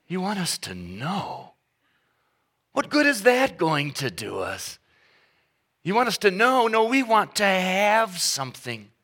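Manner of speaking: put-on high, indignant kids scoffing. Kids scoff at dad wanting them to know, indignant. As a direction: scoff at the idea like a spoiled kid. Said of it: "And they go".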